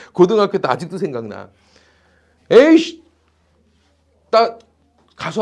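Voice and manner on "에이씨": higher, louder exasperated blurt; mock angry outburst. Teacher acting out his teenage self storming off, mock angry outburst. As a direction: burst out as if fed up, playfully.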